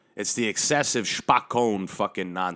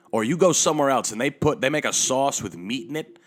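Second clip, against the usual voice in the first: ordinary talk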